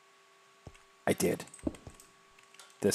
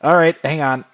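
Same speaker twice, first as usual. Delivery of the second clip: louder and higher, calling across the room; loud, higher call to hold on; 7 semitones higher, 13 dB louder than usual. Real, not acted. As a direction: raise your voice to the caller. Calling out to the caller.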